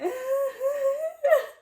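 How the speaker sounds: very high, loud whimpering giggle; acted overwhelmed squealing laugh; fangirl squeal. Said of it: "those fangirl interactions, like, that's how I felt".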